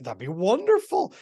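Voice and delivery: women answering the question, mock delighted. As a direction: play it as a thrilled woman answering. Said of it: "And women".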